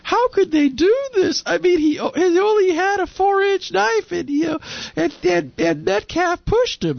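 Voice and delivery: high, slow, whining pundit voice; mock their whining outrage; mocking whiny pundit. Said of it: "the panelists or whatever".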